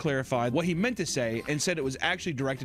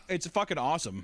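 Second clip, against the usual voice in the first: ordinary talk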